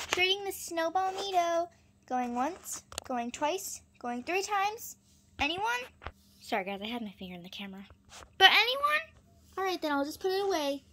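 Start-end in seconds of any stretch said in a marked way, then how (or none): 0.1-5.8 auctioneer call. Pretend. Auctioning item to imaginary buyers. 8.4-9.0 loud, high call to the room. Pretend. Calling for pretend buyers.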